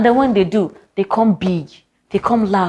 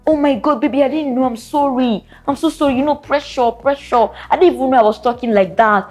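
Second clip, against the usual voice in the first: high, fawning mock apology; high hammed-up sorry, baby; mock sweet apology; 5 semitones higher than usual. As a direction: imitate him sweet-talking at home. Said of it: "He goes all".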